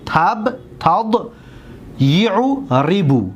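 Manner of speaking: slow teacher breakdown; slow syllable demo. Shown as a demonstration. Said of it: "حرکت را دقت بتید".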